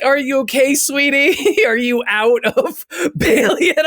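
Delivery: a doting grandma asking through giggles. Retold or imitated. Grandma voiced in story.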